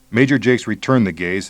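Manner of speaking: usual speaking voice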